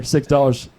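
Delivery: usual speaking voice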